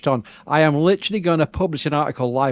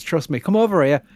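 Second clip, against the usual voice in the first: normal talking voice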